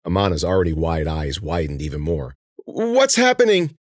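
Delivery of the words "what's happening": high, slow, frightened cry; scared out of her wits; panicked. Acted. Girl stung, wound spreading.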